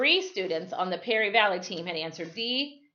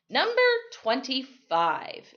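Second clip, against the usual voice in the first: slow, drawn-out announcement; slowly; 7 semitones higher, 4 dB louder, slower than usual. Acted. Quizmaster announces the final question, slowly. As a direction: stretch each word like a suspenseful host. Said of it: "Mister Kingsley said slowly".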